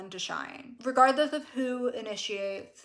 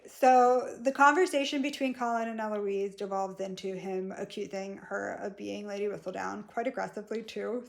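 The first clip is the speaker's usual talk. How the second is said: ordinary talk, nothing marked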